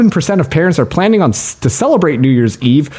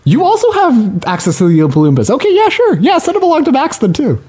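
much higher, chipper voice into a fake phone; pretend phone call; 4 semitones higher than usual. Host fakes a phone call sending Oompa Loompas after Max. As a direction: play it like calling in a favor.

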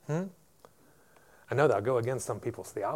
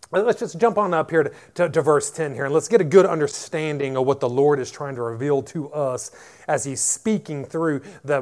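normal talking voice

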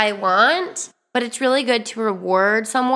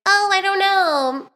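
high, reluctant oh I don't know; mock fussy dodge; controlling hesitation; 8 semitones higher than usual. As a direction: stall the plan like a controlling date. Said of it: "I would usually be like".

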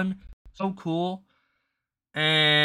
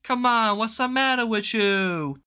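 stretched, high protesting whine; mock schoolkid complaint, drawn out; whining kids; 5 semitones higher, 4 dB louder, slower than usual. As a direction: groan like a kid losing recess. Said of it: "it'd be like".

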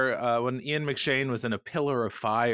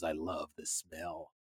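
relishing villain; 9 semitones lower, 14 dB quieter, slower than usual. Retold or imitated. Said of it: "He goes".

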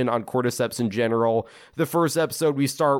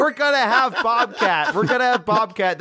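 mock hype announcement; 8 semitones higher than usual. Mocking how a promoter would advertise the show, pitched high. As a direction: hype it like a carnival barker.

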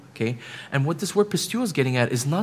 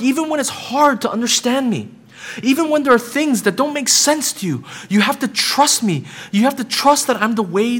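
higher and louder, building pulpit appeal; impassioned preaching; 5 semitones higher, 4 dB louder than usual. Acted. Preacher pleading with friends to trust Jesus, impassioned preaching. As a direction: drive it home, urging them to trust.

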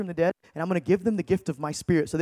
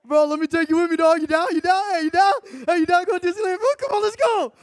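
much higher, pushing pleading hype; eager invite; 8 semitones higher than usual. Acted. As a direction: beg your buddy to come along. Pitching friend the Disneyland trip.

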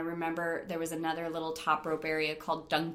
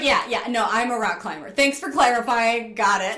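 sarcastic retort; 4 semitones higher, 8 dB louder than usual. Retold or imitated. Mocking her own retort.

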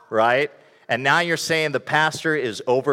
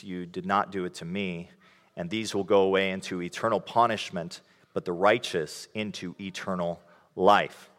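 lower, softer solemn Bible reading; scripture reading; 4 semitones lower, 5 dB quieter than usual. Acted. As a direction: read it like scripture in church. Reads scripture passage aloud.

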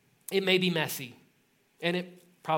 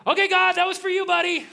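much higher, much louder relieved whoop; relieved cheer; 14 semitones higher, 9 dB louder than usual. Retold or imitated. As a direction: shout it up to God like a buddy. Cheering after awkward blessing.

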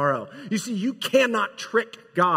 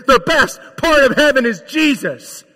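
higher, louder, booming proclamation; full-force preaching; 7 semitones higher, 10 dB louder than usual. Acted. Pastor announces to the congregation, loud preaching. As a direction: preach it out to the whole room. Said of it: "can I just be a preacher for a second".

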